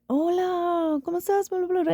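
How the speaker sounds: high, sing-out mock phone greeting; imitated Spanish greeting. Imitating husband switching to Spanish on a call, high-pitched greeting.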